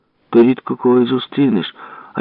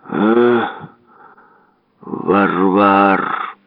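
drawn-out grumble of reproach; grumbling old man's rebuke; scolding grandfather; much slower than usual. A narrator's reading. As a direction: growl the insult as a cross grandfather. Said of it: "завжди говорив при цьому різні погані слова".

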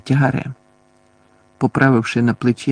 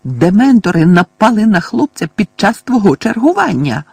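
higher, louder scolding; old woman accusing a negligent guard; shrieking accusation; 8 semitones higher, 6 dB louder than usual. A narrator's reading. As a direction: hurl the blame at him. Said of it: "верещала місіс Фіг".

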